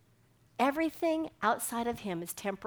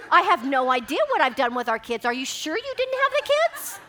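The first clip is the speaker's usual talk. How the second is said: louder and much higher, rising into alarm; panicked; 5 semitones higher, 7 dB louder than usual. Retold or imitated. Mother asking husband if he's sure, high panic. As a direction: climb into frantic disbelief.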